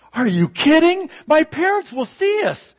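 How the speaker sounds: put-on high, loud nervous girl. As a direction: squeak it like a startled teen girl. Girlfriend voiced in joke.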